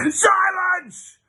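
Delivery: high, loud bellow of silence; shouted order. Acted. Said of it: "ordered Splat".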